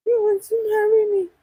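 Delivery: high, fast and loud, caught off guard; surprised question. Real, not acted. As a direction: blurt it out in disbelief. Reading a viewer's question aloud.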